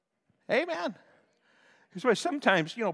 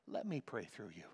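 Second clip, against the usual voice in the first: gentle Holy Spirit; 5 dB quieter than usual. Retold or imitated. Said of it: "The Holy Spirit reminds me".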